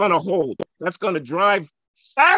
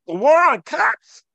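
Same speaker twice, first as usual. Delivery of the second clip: higher and louder, hammering the phrase; emphatic outburst; 10 semitones higher, 6 dB louder than usual. Real, not acted. Debating the host on race, a loud emphatic outburst. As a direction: fire the words "The war on cops" out as an accusation.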